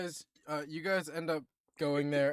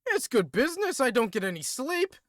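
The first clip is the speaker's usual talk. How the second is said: higher, louder British complaint; grumbling British dwarf; 9 semitones higher, 5 dB louder than usual. Acted. Dwarf blacksmith complaining to the party, British grumble. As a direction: moan about the workload in a British accent.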